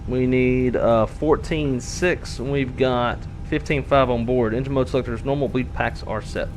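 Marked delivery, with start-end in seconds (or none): none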